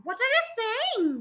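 high-pitched, incredulous cry; narrator's alarmed question; cried out. A narrator's reading. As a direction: exclaim high without extra volume. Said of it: "the husband cried".

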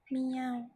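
played storybook cat meowing. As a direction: call out a bright cat meow. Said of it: "said Sally cat".

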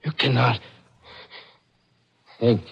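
broken words with long pauses; dying gasps. Acted. Pawnbroker dying before his killer, gasping word by word. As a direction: force out each word with a last breath. Dying after being shot.

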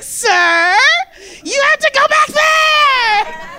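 slow, high, loud gleeful order; gleeful shout. Retold or imitated. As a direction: belt it out with glee. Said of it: "gleefully was like".

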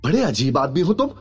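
high-pitched indignant complaint; angry girl. A narrator's reading. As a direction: demand the manager in a high, angry voice. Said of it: "उस लड़की ने गुस्से में कहा".

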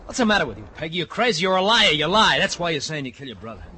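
furious high shout; angry shouting. Acted. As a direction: scream at her in rage. Ralph screaming at Peggy.

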